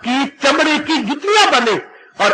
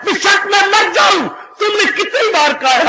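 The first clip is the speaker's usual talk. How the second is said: defiant shout; 9 semitones higher than usual. Retold or imitated. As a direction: shout back at the killer. Devotee defies his killer. (मारने वाले को निडर ऊँची ललकार)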